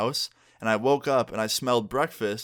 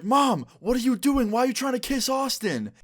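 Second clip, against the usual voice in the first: shocked outburst; 13 semitones higher than usual. Retold or imitated. Friend shocked at mom's kiss.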